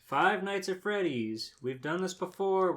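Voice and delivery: high, loud robotic announcer. Acted. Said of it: "your impression of the robot voice".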